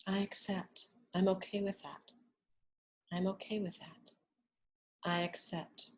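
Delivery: soft, unhurried affirmations that fade at each end; guided affirmation. Acted. Teacher guiding listeners through acceptance, softly. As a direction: murmur each acceptance gently.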